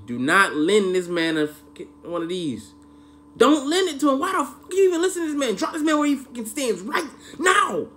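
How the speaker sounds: high, loud, urgent command; a hammed-up furious warning; yelling at the screen. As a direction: bark orders at the characters. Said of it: "so I'm like".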